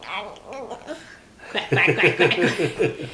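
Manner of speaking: duck quacks. Shown as a demonstration. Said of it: "What does a duck say?".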